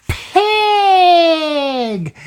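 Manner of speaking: high loud squeal on pig. Acted. PJ picks the pig, a high loud squeal.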